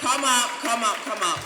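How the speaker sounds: loud, high call to come up; loud onstage welcome call. Real, not acted. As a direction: beckon him up with a hearty shout. Calling the speaker onstage.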